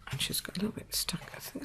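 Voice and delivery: under-the-breath whisper while fiddling with the punch; whispered aside. Real, not acted. Crafter stuck with a glue-jammed punch, whispering to herself. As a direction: whisper it to yourself, half forgetting the camera.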